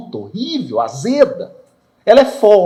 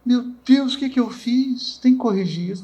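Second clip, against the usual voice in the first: dismayed realization; 9 semitones higher than usual. Retold or imitated. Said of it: "não é assim?".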